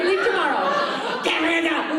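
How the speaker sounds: normal talking voice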